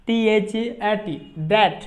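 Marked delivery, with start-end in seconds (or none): 1.5-1.8 that with th said as Bengali দ. Shown as a demonstration. Teacher demonstrates the word to learners, careful pron. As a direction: model that starting with a voiced দ. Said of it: "উচ্চারণটা সাধারণত দর মতো".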